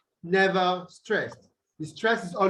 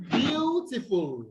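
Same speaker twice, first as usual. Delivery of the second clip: "beautiful" drawn out slowly on its first syllable, pitched higher; stress on first syllable; 4 semitones higher, slower than usual. Shown as a demonstration. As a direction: stretch and lift the opening of "beautiful". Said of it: "you also have the stress on the".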